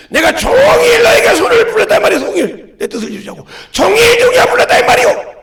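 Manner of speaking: high, loud preaching shout; fervent pulpit shouting that peaks on the repeats; impassioned reproach. Acted. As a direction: cry out at full force as God pleading. Preacher voices God's reproach.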